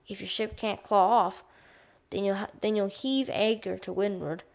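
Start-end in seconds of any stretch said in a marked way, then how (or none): none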